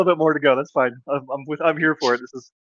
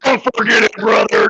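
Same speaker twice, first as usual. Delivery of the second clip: over-the-top wrestling hype voice; wrestling promo; 4 semitones higher than usual. Acted. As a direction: cut a promo like a pro wrestler, brother.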